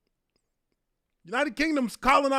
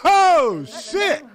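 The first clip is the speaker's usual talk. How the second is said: loud shocked cry; shocked outburst; 3 semitones higher, 8 dB louder than usual. Real, not acted. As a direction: burst out in real shock. Shocked reaction to a clip.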